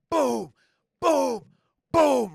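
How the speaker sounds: loud, punched booms; pounding booms. Retold or imitated. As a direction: bang out each boom loudly. Imitating hitting his car.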